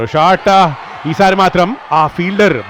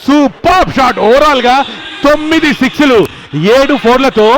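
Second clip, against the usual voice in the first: excited cricket commentary; 6 semitones higher, slower than usual. Real, not acted. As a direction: roar the winning six. Match-winning six called live.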